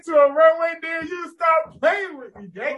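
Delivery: loud, high, slow call-out; high slow bragging shout; hype shout. Real, not acted. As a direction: boast it to the crowd, dragging it out. Hyping himself up, calling out.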